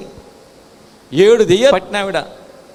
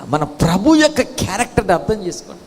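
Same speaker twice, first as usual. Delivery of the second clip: ordinary delivery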